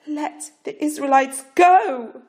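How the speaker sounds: loud, high, slow shout. A narrator's reading. Parrot shouting at Pharaoh before the court. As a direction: shout the demand at the king. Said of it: "Parrot shouted".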